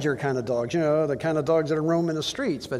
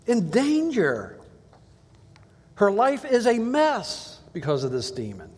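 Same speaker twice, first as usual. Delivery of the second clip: pleading mother; 5 semitones higher than usual. Acted. Woman begging Jesus for daughter.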